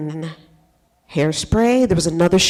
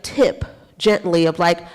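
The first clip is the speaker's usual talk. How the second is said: ordinary talk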